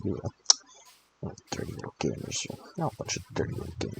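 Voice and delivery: rushed mocking whisper; teasing whisper to the viewers; whispered taunt. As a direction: hiss it fast at the gamers. Teasing viewers as dirty gamers.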